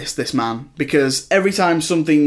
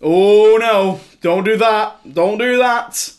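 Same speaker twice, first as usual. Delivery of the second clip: imitating a heckler, louder and climbing higher; mock shout at screen; 4 semitones higher, 4 dB louder, much slower than usual. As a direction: plead loudly at the screen, rising. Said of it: "shouting at the screen".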